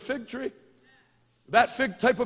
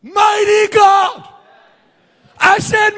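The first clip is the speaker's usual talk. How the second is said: high, loud, slow proclamation; booming drawn-out praise; preaching at full force; 12 semitones higher, 18 dB louder, much slower than usual. Real, not acted. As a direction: proclaim each word with full weight.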